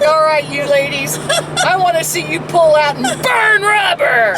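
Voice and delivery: high-pitched mock cop; hammed-up bossy policeman. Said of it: "The cop's gonna be like".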